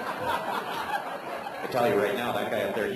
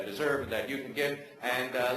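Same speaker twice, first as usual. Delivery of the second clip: ordinary talk, nothing marked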